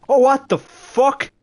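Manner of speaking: high, slow, loud startled cry; shocked outburst. Real, not acted. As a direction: caught off guard, blurt it out. Boots suddenly gone.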